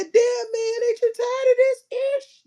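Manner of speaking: squeaky high-pitched whine; movie quote impression. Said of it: "He said".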